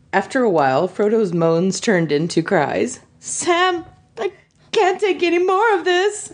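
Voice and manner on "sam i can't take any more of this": keening. Acted. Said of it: "he keened".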